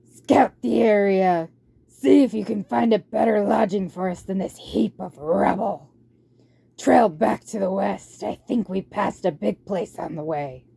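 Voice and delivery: loud, rough warlord barking orders at his horde; a tyrant rat commanding his troops. Acted.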